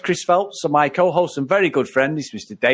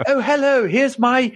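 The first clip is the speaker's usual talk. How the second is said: slow, higher mock posh English voice; 7 semitones higher, slower than usual. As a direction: introduce the niece in an overdone posh tone. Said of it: "Dave's a bit more posher".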